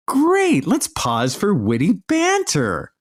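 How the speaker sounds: high mock-cheerful great; sarcastic great. Real, not acted. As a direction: fake delight, dripping sarcasm.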